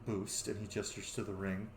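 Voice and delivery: low, soft, measured godly voice; solemn, refined god. Acted. God explains his bound soul to a mortal, low and solemn. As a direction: speak quietly with grave restraint. Game master plays Bahamut.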